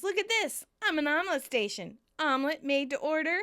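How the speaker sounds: showing off excitedly. Retold or imitated. Monica showing off new pans.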